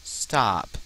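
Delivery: ordinary talk, nothing marked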